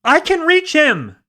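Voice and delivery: high, loud, slow, determined; straining shout. A narrator's reading. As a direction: push through the effort. Straining to save the driver.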